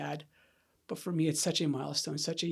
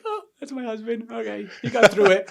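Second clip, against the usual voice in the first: higher, put-on doting reaction; imagined wife's voice; 6 semitones higher, 8 dB louder than usual. Wife hearing husband's recorded story, lightly put-on voice. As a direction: voice a wife proudly listening in.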